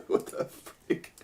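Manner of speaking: breathy, slow whispered disbelief; whispered aside. Real, not acted. Stunned by a selfish dog, a slow whisper. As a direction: hush it out to yourself in shock.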